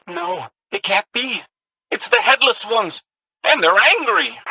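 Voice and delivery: backing away from a threat it feared; alarmed. Acted.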